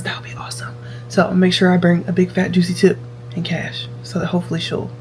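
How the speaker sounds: unvoiced, hushed aside; whispering. Real, not acted. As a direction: whisper it like a secret. Secretive aside about the tip.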